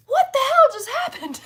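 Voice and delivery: high, loud laughing cry of disbelief. Retold or imitated. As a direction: burst out laughing in shock. Kids running over, stunned.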